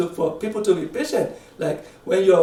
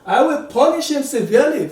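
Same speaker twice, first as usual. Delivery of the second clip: lightly acted ruler's threat, pitched higher; Solomon; 7 semitones higher, 3 dB louder than usual. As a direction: declare the punishment in a raised voice. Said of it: "Solomon talking".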